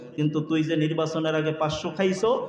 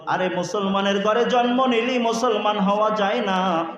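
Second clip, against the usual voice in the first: ordinary talk, nothing marked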